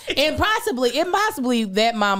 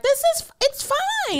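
very high, drawn-out mock reassurance.

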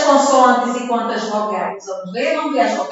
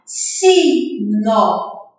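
"Sino" split into two distinct syllables, si, no; teacher's demo of "Sino" in two separate beats; sounded-out syllables; 2 semitones lower than usual. Shown as a demonstration. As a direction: sound out "Sino" slowly for a class. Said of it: "Olha a sonorização da fala".

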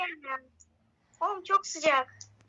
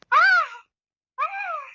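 pained cry; 10 semitones higher than usual. Pretend. Acts burning hot, hint game.

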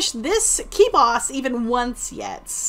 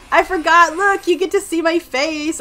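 excited; 5 semitones higher, 7 dB louder than usual. Real, not acted. Excited discovery in game.

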